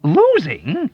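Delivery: high, loud rising echo; incredulous cry. A narrator's reading. Doctor crying out at the soldier's question. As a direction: cry the word back in disbelief. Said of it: "Doctor Nika cried".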